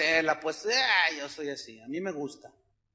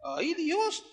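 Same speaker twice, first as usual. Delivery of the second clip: "Ay, Dios" pitched higher, sliding up in alarm; second "Ay, Dios" climbing into panic; higher, more alarmed plea; rising panic; 8 semitones higher, 4 dB quieter than usual. Retold or imitated. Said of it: "Yo dije".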